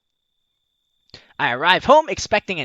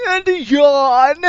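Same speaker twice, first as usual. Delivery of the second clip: slow, higher hammed-up yawn; fake yawn; 6 semitones higher, 4 dB louder, much slower than usual. Hammed-up fake yawn for the arm move. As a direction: stretch it into a big fake yawn.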